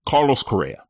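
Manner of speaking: normal talking voice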